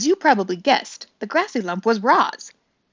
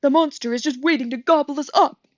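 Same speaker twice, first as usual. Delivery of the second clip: higher frightened shriek; mild shrieking groundhog, higher; 6 semitones higher, 4 dB louder than usual. A narrator's reading. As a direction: cry it out in panic before diving away. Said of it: "shrieked Digdown".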